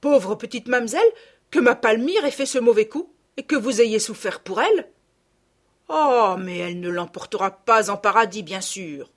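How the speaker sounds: indignant country mother. A narrator's reading. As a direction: exclaim in outrage for the poor girl. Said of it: "Elle prit un air indigné".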